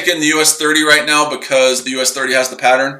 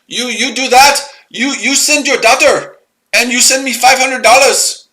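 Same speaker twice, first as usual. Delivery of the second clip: put-on much higher caricature Indian accent throughout; mock Indian accent; 6 semitones higher than usual. Ranting at viewers as a scammer, high mock Indian accent. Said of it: "I speak India's accent".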